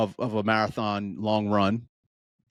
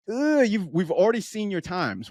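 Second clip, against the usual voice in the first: exasperated; 8 semitones higher than usual. Retold or imitated. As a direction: sigh it out at the stubborn runner. Exasperated at runner.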